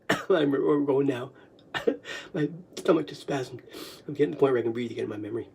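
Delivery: very soft, low, fast aside; hushed aside to self; quiet aside. Real, not acted. Quiet aside while laughing.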